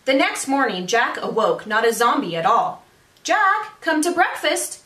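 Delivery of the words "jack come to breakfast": a hint of a mom calling upstairs, higher; mother calling. His mother calls Jack to breakfast, lightly voiced. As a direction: call him down to breakfast. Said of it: "his mother did call".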